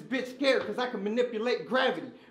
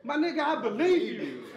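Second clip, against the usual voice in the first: usual speaking voice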